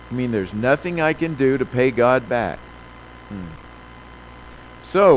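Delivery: ordinary talk, nothing marked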